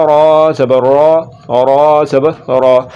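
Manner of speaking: heavy recitation "रा"; full, thick R. Shown as a demonstration. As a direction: thicken "रा" toward "aw", drawn out. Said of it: "ज़ुबान की जड़ को तालु की तरफ बुलंद".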